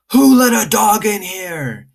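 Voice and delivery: high, slow, loud angry yell from the store manager; shouting manager. Acted. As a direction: bellow it like a frantic boss. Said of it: "he kept on shouting".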